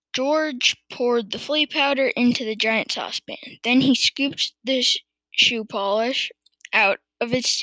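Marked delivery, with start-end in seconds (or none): none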